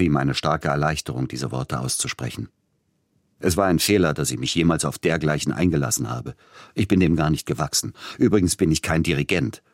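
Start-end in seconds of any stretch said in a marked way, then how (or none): none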